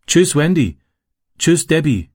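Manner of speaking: high-pitched goodbye call. A narrator's reading. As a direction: call goodbye into the next room. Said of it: "rief er".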